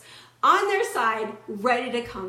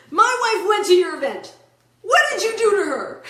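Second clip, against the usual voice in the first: angry husband; 7 semitones higher, 10 dB louder than usual. Retold or imitated. Husband demands answers on phone.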